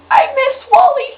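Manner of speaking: high, loud, slow lament; grieving whine. Real, not acted. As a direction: wail it like a mourning fan. Fan mourning a dead character.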